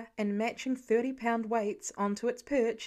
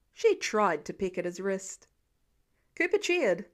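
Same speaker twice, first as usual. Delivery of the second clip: ordinary delivery, nothing marked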